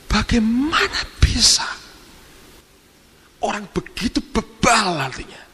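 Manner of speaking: high, slow, breathy outraged hiss rising to harsh cries; harsh rebuke. Retold or imitated. Visiting preacher rebuking the church for stopping the weeping, harsh outrage. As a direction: start in a hissed whisper, then let the outrage break out. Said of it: "Ngomongnya kasar".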